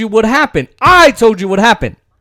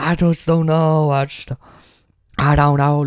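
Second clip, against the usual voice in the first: lightly voiced, unsure mumbling mimicry; mock dithering senator; 5 semitones lower, 4 dB quieter than usual. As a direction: play him as a waffling, undecided politician. Mocking a hesitant senator.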